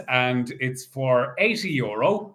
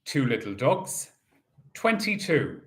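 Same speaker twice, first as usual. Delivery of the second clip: bingo caller. Acted. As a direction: call each ball out steadily. Calls bingo numbers.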